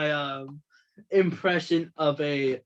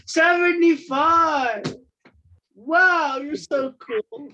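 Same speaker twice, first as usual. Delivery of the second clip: much higher, louder fake excitement; mock amazement; 11 semitones higher, 6 dB louder, slower than usual. Mocking a YouTube magician with shrill fake awe. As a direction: gush over the answer, hammed up.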